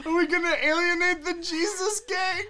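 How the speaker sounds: very high, loud, giggly disbelief; cracking up while asking; laughing question. Real, not acted. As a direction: squeal it through laughter.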